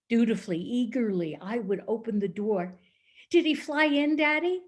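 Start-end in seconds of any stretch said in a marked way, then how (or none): 3.3-4.6 put-on higher child's question; imitating herself as an eager child; eager little girl. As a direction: ask Daddy eagerly, pitched up. Child eagerly asks her dad.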